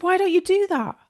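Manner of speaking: lightly imitating her eager offer; mild mock of a breezy therapist; lightly voiced acupuncturist.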